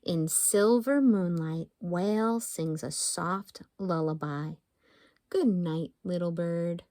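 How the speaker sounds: lullaby-like reading; calm picture-book poetry voice; gentle bedtime poem reading. Acted. Reading haiku to children.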